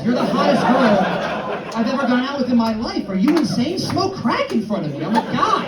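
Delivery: high, loud, slow incredulous yelling through laughter; hammed-up, laughing, high and loud; ecstatic disbelief. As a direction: lose it in delighted disbelief. Comedian's ecstatic disbelieving reaction.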